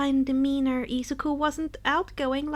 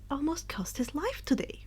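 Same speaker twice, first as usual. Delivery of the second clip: whisper; 8 dB quieter than usual. A narrator's reading. Ominous aside in narration.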